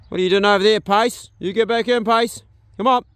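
loud, high call across the paddock; calling the dog. Real, not acted. Owner calls his dog Pace back in, loud and high. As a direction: shout it out to a wandering dog.

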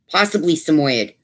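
usual speaking voice

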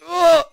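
high, loud "ugh"; frustrated outcry. Real, not acted. Player failing while focused, loud frustrated groan. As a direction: yelp out a groan.

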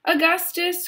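very high, urgent call; crying out. A narrator's reading. Mister Gloop to his son, crying out. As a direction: echo the cry in alarm. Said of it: "cried Mister Gloop".

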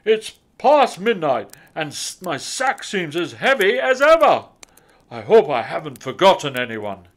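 loud Santa voice, rushing at the end; loud fretting Santa; worried Santa. A narrator's reading. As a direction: fret like Father Christmas.

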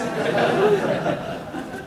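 high airy laugh at his own story; breathy laugh. Real, not acted. Speaker laughing at his professor story, high airy laugh. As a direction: let out a real amused laugh.

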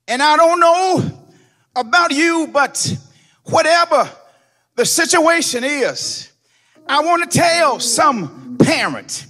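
preacher lifting the church at the close; building preaching climax. Acted. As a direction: rise into the closing cry of the sermon.

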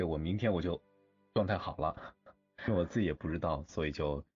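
ordinary talk, nothing marked

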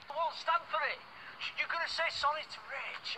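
angry demand. Acted. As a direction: lay down the law loudly. Quarrel demanding an apology.

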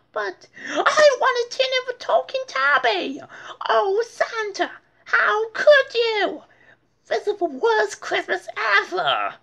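high, loud tantrum wail; whiny little girl. Acted. Little sister wailing at her present. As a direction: wail it like a spoiled kid. D W wails about her gift.

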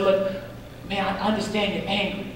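soft, quiet reassurance to an angry brother; gentle calming. Retold or imitated. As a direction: talk him down softly. Pastor calms angry young man.

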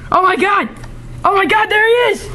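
high, frantic yelling; panicked shouting. Pretend. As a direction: scream in terror. Soldiers spot the monster.

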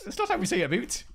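high-pitched Canadian mimicry, "about" as "aboot"; exaggerated teasing Canadian accent; mock Canadian accent. Joining in teasing a Canadian friend, mock Canadian accent. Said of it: "Canadians say a boot".

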